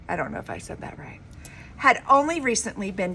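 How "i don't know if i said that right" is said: fast, whispered self-doubting aside; hushed quick aside. Real, not acted. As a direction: rush it under your breath. Aside after a hard name.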